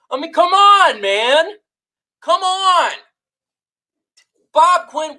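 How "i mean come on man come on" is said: higher pleading come on; fed-up fan groan; exasperated. Real, not acted. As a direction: throw your hands up in disgust. Fan exasperated at team moves.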